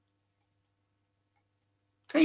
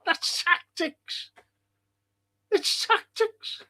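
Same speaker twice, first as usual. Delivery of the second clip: mocking; 11 semitones higher, slower than usual. Mocking the manager's excuse.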